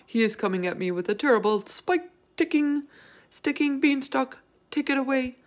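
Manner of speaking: high, wailing plea for mercy; panicked giant begging in his sleep; frightened pleading. Acted. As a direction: beg desperately, as if under attack. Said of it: "screamed the flesh lump eater".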